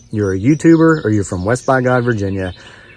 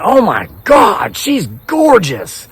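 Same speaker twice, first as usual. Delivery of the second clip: high, drawn-out imitation of awe; mock amazed gushing; 10 semitones higher, 3 dB louder, much slower than usual. Woman seeing his wife's picture, hammed-up amazement. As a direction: gush in an exaggerated high voice, stretching the words.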